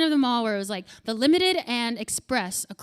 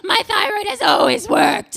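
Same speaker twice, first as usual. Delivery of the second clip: high loud laughing shout; laughing brag; 9 semitones higher, 10 dB louder than usual. Retold or imitated. Skinny audience member bragging, high laughing shout. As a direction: crow it through laughter. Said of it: "she's laughing like".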